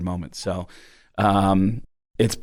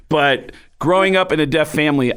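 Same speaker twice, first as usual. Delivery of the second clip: normal talking voice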